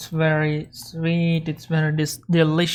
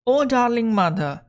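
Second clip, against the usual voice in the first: put-on pleading child's voice, pitched higher; begging child; 6 semitones higher than usual. Child coaxing mother for pancake, high sweet plea. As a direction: sweetly beg like a hungry child.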